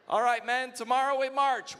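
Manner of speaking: loud, high rallying cry to the troops; booming rousing general speech. Acted. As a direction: rouse the men like a general before battle.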